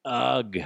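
exaggerated fed-up groan; dramatic sigh. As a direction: heave a big weary "ugh". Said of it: "You're like".

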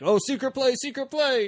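high-pitched mock call-out; playful warning call. Quarterback calling to lady on balcony, high playful mock warning. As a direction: sing out a teasing warning, high.

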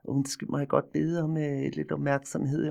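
prissy Hellerup politeness, drawn out; mocking a polite Hellerup boy asking for attention; posh Hellerup accent. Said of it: "meget Hellerupsk-agtigt".

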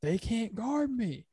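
yelled trash talk. Retold or imitated. Podcaster imitates Shai boasting to teammates, high yelled trash talk. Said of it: "he's yelling or he's, like, talking trash".

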